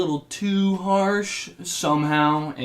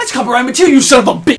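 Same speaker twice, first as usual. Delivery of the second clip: furious yell, much louder; angry shout; 10 semitones higher, 14 dB louder, much faster than usual. Acted. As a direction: bellow it in outrage. Executive yells about the music.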